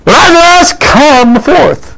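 high, ringing summons; commanding call as Jesus. Retold or imitated. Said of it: "what did he say?".

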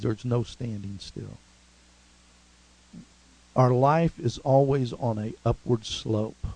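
hushed, low sermon delivery with long pauses; solemn preaching. Real, not acted. Solemn sermon introduction, recites hymn.

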